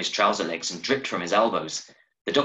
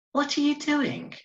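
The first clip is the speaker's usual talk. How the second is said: put-on high, bright little girl's voice; little sister calling her brother home, high child voice. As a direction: play a small girl fetching her brother. Child Phoebe voiced in story.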